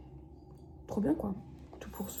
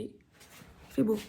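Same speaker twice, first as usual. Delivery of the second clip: drawn out; much slower than usual. Real, not acted. Drawn-out reassurance about weather.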